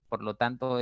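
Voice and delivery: ordinary delivery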